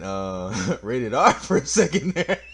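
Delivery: real laughter breaking into the words; laughing. Real, not acted. Player admitting a near R-rated slip, laughing.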